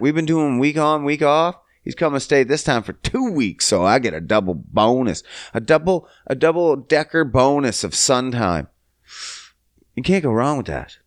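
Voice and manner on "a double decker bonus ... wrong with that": normal talking voice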